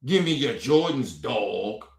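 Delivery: mock a phony street-talk line, dropping low at the end; lightly put-on bozo street voice; mocking imitation. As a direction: scornfully mimic someone faking street slang. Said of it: "You trying to imitate us?".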